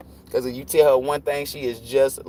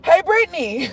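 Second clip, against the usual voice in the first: put-on pestering kid; 14 semitones higher, 4 dB louder than usual. Stepkid interrupting the movie, imitated as a high pestering call. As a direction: imitate a little kid calling for attention. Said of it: "guess what she did".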